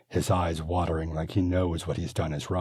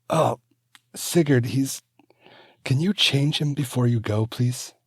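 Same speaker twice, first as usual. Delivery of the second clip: wife pleading; 9 semitones higher, 7 dB louder than usual. Acted. Wife voiced in story.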